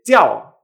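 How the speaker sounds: "jiao" unaspirated, loud falling tone; no air. Shown as a demonstration. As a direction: hit "jiao" with a J and no puff. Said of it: "donde no sale aire".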